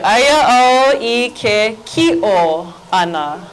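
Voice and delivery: slow, spaced-out "aya au i ke ki'o ana"; slow teaching model. Shown as a demonstration. Teacher showing the class a new verb, slowly modelled. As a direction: draw out every word for learners to follow. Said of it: "everybody together".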